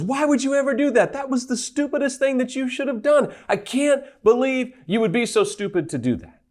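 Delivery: high-pitched scolding inner critic; berating ego. Acted. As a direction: scold in disbelief, pitched well up. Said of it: "the ego comes back and goes".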